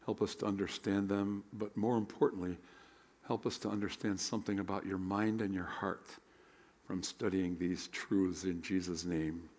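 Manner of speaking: prayer. Acted. Leads opening prayer.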